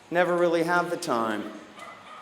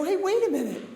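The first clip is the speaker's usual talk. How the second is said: realizing; 8 semitones higher than usual. Retold or imitated. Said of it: "and I go".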